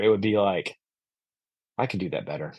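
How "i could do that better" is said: low, soft aside to oneself; quiet, low-pitched critical thought; muttered inner thought. Retold or imitated. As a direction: mutter it under your breath, privately unimpressed. Retold proud private thought while listening.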